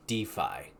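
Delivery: "DeFi" said as DEE-fye; DeFi as one word. Real, not acted. Host introducing today's topic, "DeFi" pronounced DEE-fye. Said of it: "I think that's how it's pronounced".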